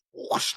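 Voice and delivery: rushing whoosh noise; imitated whoosh. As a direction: make a swift rush-of-air whoosh.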